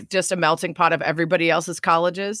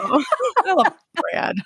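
laughing fondly; 11 semitones higher, much slower than usual. Real, not acted. Joking about husband's plain accent.

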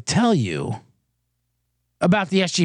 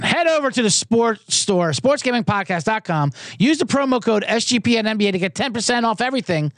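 proper ad read; 3 semitones higher than usual. Shown as a demonstration. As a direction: redo the plug like a pro announcer. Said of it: "Let me say that again".

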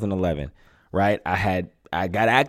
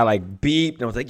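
ordinary delivery

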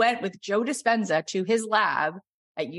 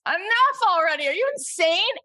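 high, pleading voice of an aching body; whiny body; 11 semitones higher than usual. Retold or imitated. Body protesting to hiker, high whiny complaint. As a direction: complain like your worn-out legs. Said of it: "where my body goes".

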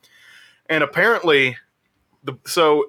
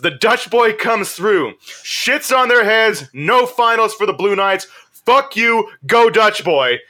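higher, drawn-out triumphant retelling; crowing play-by-play; 6 semitones higher, slower than usual. Retold or imitated. Storyteller relives Dutch Boy knocking Blue Knights out, crowing. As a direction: hammer out each blow of the upset.

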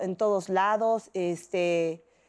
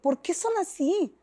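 pleading with mom, a little heated; upset protest; 8 semitones higher, slower than usual. Retold or imitated. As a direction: demand why people are cruel. Protests to her mother.

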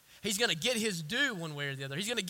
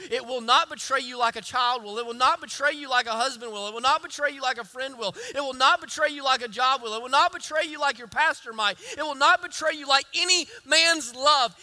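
rising preached shout; 7 semitones higher, 9 dB louder than usual. Real, not acted. Pastor preaching to the church, building to a shout. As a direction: stress NOT, building louder each line.

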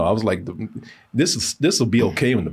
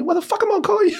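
much higher, disbelieving outburst; incredulous mock retort; much faster than usual. Telling a friend how absurd the invite was, incredulous. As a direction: scoff at the very idea.

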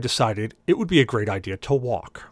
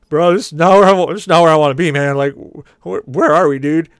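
much louder, higher alarmed shouting; panicked yell; 5 semitones higher, 11 dB louder than usual. Retold or imitated. Lost drunk passenger yells at cabbie, panicked.

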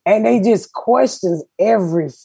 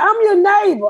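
much higher, eager neighbor voice; imitating the neighbor; 12 semitones higher, faster than usual. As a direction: mimic the neighbor greeting her by name. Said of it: "he said".

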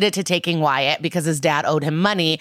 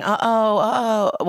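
hammed-up fake wail; mock crying; 4 semitones higher, slower than usual. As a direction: wail in a badly faked cry. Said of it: "Hector's mom is crying".

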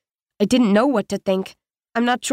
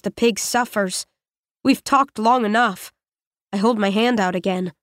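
ordinary delivery, nothing marked

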